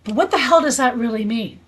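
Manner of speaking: ordinary talk, nothing marked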